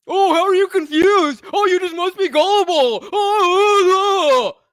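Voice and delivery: mocking imitation. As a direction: throw their words back in a sing-song sneer. Mocking a chatter's reply.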